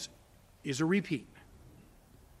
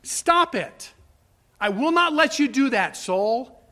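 stern self-rebuke; 6 semitones higher, 4 dB louder, slower than usual. Pretend. Rebuking his own soul.

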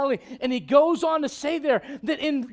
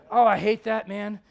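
ordinary talk

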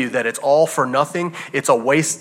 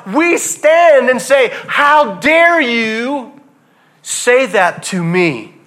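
loud, slow, indignant challenge; defiant outrage; 6 semitones higher, 7 dB louder, much slower than usual. Retold or imitated. Preacher voicing believers rebuking the world, loud and slow. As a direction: plant your feet and demand it.

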